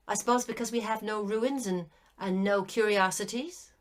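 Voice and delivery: dry mock of a stuffy ghost; satirical. A narrator's reading. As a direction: sneer at his European snobbery. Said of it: "said Virginia satirically".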